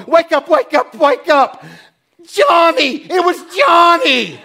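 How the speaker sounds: loud, high mock alarm call; an urgent, laughing wake-up yell; brain shouting. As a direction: shout him awake at 2 a.m. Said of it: "my brain will go".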